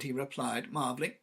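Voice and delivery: usual speaking voice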